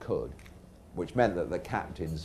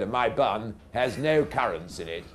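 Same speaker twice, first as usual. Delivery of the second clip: raised, earnest captain's recital; old captain; 5 semitones higher, 4 dB louder than usual. Retold or imitated. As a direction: repeat it loudly and solemnly, like a duped captain. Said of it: "old captain said".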